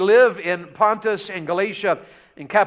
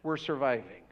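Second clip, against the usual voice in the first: quiet weary sigh; 5 semitones lower, 8 dB quieter than usual. Retold or imitated. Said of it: "They probably would have said".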